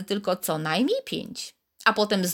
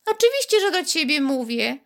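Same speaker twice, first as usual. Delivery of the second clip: high, louder little voice, a touch offended; slightly indignant; 7 semitones higher, 4 dB louder than usual. A narrator's reading. Bead answers Karolcia, a touch indignant. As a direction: squeak up in mild offence. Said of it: "lekkie oburzenie".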